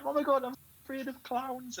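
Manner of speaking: high-pitched mock fearful quote; mock panic. Mocking film viewers scared of clowns, high mock panic. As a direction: imitate scared filmgoers in a high voice.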